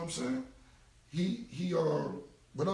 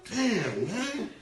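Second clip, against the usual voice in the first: mock exasperated yell; 7 semitones higher, 6 dB louder, much slower than usual. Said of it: "I'll be like".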